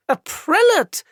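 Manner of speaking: high startled echo; surprised. Acted. Surprised director echoes.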